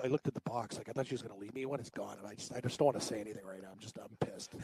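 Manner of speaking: hushed, dropping almost to a whisper so she won't hear. Real, not acted. Quietly grumbling so his girlfriend upstairs won't hear. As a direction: keep it low, she's upstairs. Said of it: "I like how Cam lowers his voice".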